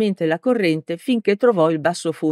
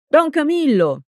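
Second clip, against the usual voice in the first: shouted name; 9 semitones higher than usual. A narrator's reading. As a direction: yell his name across the field. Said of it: "urlò Peppone".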